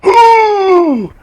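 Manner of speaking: put-on a falling old man's high whoop; cry of falling. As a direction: yelp as you hit the ground. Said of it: "He's like".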